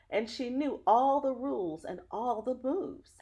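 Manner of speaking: put-on lively read-aloud voice for children; story narration. Reading a picture book to children, lively storyteller. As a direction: tell the story warmly to young listeners.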